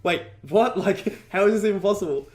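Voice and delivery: retold astonishment. Housemate hearing of huge sales, imitated as amazed. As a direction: rush out the disbelief with a laugh.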